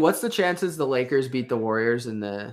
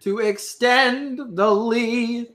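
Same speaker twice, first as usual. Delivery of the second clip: sportscaster voice; 9 semitones higher, 4 dB louder, much slower than usual. Pretend. Mock play-by-play call.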